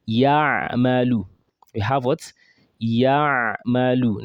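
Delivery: Ya'malu drawn out slowly from the throat; slow teaching demo. Shown as a demonstration. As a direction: model Ya'malu slowly for students to copy. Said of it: "pronounce with me".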